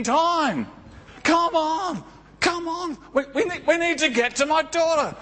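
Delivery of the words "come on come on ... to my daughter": high, pressing plea to hurry; anxious father pushing through the crowd; urgent Jairus. Acted. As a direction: beg them to hurry, voice raised high. Jairus urging Jesus to hurry.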